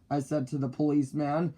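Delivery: plain reading voice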